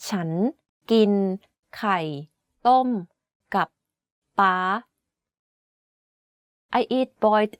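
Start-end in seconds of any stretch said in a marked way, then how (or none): 0.1-4.6 ฉัน กิน ไข่ ต้ม กับ ป๊า said one word at a time with pauses; careful word-by-word Thai; slowly, word by word. Shown as a demonstration. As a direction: spell out each word of ฉันกินไข่ต้มกับป๊า slowly. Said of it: "Let me say this sentence again slowly".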